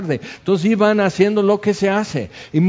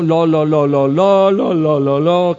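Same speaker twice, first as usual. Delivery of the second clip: widows' lament, raised and wailing; mock mourning wail; 3 dB louder than usual. Widows weeping over Dorcas, wailing loudly. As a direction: imitate mourners crying out no. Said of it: "gritando en arameo".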